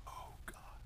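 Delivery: whispered under his breath, dreading it. Retold or imitated. Dad whispering to himself, dreading the long drive.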